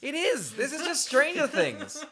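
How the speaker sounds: high, loud laughing disbelief; amused outrage at a blatant copy. Real, not acted. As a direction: shout it out while cracking up. Outraged laughing at ripoff film.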